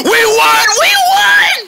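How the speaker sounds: very high, loud victory cheer; excited cheer. Pretend. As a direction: squeal with the joy of winning. Team wins basketball game.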